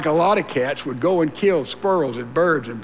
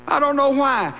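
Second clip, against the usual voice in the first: mock complainer; 7 semitones higher than usual. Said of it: "Somebody says".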